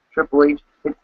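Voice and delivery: normal talking voice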